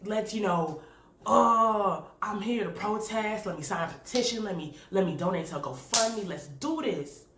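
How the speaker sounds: mock gung-ho protest energy; fired-up activist. Vlogger mimicking her fired-up protest self, hammed-up rallying. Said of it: "some days, like, I'm all about".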